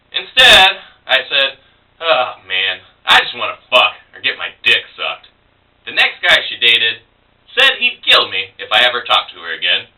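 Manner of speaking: spoken-word poem with punched turning words; comic storytelling verse, paused between phrases; poetry reading. Acted. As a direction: perform the poem in measured phrases with lively pitch swings. Performs own poem.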